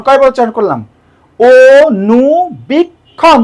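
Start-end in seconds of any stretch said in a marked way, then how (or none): 1.4-3.4 অনুবীক্ষণ very slowly as "o-nu-bik-khon"; syllable by syllable. Shown as a demonstration. Teacher counts syllables, অনুবীক্ষণ slowly drawn out. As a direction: hold each of the four beats of অনুবীক্ষণ. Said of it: "চারবার উচ্চারণ করলাম".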